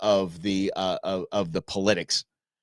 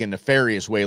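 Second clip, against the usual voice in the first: normal talking voice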